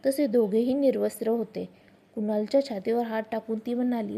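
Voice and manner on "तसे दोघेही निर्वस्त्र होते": ordinary delivery, nothing marked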